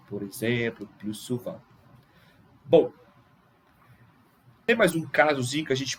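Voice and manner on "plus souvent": "plus" as "plu" before souvent, soft; silent S. Shown as a demonstration. Teacher recapping consonant rule, soft "plus souvent" with silent S. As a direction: softly drop the S of "plus". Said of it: "a gente não pronuncia o S".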